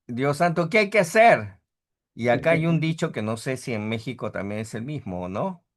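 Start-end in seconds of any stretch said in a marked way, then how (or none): 0.1-1.6 exasperated. Retold or imitated. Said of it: "y uno dice".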